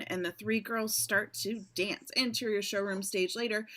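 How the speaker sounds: normal talking voice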